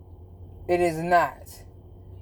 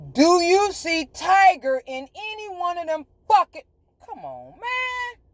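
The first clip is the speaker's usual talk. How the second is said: exasperated; 9 semitones higher than usual. Real, not acted. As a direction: plead at their stupidity. Exasperated at followers.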